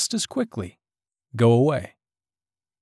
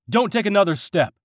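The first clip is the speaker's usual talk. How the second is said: yelling; 7 semitones higher than usual. A narrator's reading. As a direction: shout him off the ramp. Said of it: "John yelled".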